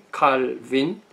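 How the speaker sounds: 칼빈 in two separated syllables, aspirated k; drawn-out German-style 칼 then 빈; German pronunciation. Shown as a demonstration. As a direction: say 칼빈 the German way, ending in -빈. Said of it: "독어식 발음이".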